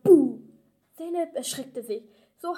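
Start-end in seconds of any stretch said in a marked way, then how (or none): 0.0-0.4 sudden loud shout; loud boo. Acted. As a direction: jump out and yell it. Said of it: "Ich rufe laut". (lautes Buh)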